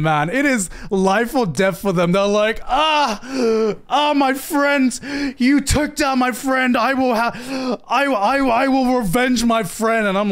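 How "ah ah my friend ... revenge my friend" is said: higher-pitched mock-anguished anime gang member; hammed-up vow of vengeance, voice pitched up; melodramatic high schoolers. Mocking gang kids avenging a fallen friend, melodramatic. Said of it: "it's life or death for them".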